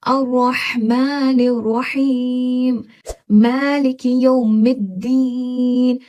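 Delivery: very slow, heavy ha in अर्रहमानिर रहीम; heavy ha recitation. Shown as a demonstration. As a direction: drag each ha heavily from the throat. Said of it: "हा कितना heavy हम पढ़े". (भारी हा के साथ बहुत धीमी तिलावत)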